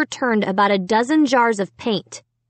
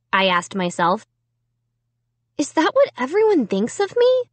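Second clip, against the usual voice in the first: high hurt questioning; 6 semitones higher than usual. A narrator's reading. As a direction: ask yourself in disbelief. Hurt inner monologue.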